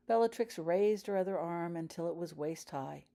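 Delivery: usual speaking voice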